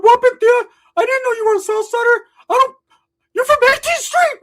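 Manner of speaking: very high, loud cartoon squawk; hammed-up squealing cousin; [name]. Cousin winding up his aunt, shrill yelling.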